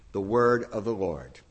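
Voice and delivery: low, falling solemn formula; solemn church formula; liturgical response. Acted. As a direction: close the reading with reverence.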